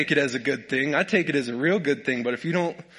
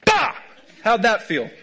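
a sudden loud bark of a payback; mock wife retort; 7 semitones higher, 6 dB louder than usual. Preacher imagining his wife getting even, mock shout. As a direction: hit back with a shout. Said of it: "her to come over and".

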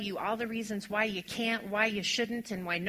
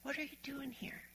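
much softer, higher tender question; kindly asked; 5 semitones higher, 14 dB quieter than usual. Acted. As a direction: ask it with gentle kindness. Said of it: "responds so kindly to Elijah".

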